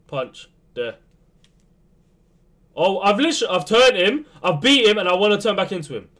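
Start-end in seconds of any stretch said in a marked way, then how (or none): 2.8-6.1 exasperated rant. Real, not acted. Gamer ranting at his player.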